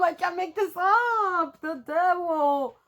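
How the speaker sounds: high, slow, laughing through the words; laughing disbelief. Real, not acted. Tarot reader to viewers on drawing the devil card, laughing disbelief.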